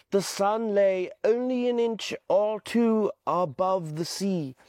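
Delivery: ordinary delivery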